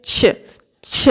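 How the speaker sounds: ছ said as Bengali c and chh fused; ch-chh blend. Shown as a demonstration. Teacher modelling chieut for learners, demonstrated ch-chh blend. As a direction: say ছ as one short aspirated chh. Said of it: "এরকম একটা উচ্চারণ হবে".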